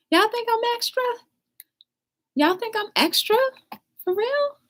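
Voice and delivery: very high-pitched incredulous question; playful disbelief. Real, not acted. Streamer asking viewers if they think she's extra, high disbelief. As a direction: squeak it out, playfully taken aback.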